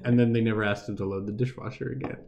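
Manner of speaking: ordinary talk, nothing marked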